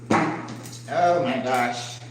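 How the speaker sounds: slow, choked-up sigh of words; emotional, choked up. Real, not acted. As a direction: let it out slowly, moved close to tears. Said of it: "I do get emotional over this".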